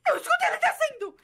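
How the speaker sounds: high, startled scream after a gasp; panicked cry. Real, not acted. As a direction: cry out in sudden fright. Spots the alien coming down, panics. (grito agudo de susto)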